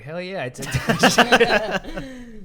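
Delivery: high, drawn-out boast cracking into laughter; laughing admission. Retold or imitated. Friend retelling Mikey's confession about the remote, laughing.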